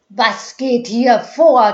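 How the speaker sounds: loud, slow, booming demand; threatening thunder. A narrator's reading. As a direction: thunder it at the mob. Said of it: "donnerte seine Stimme drohend".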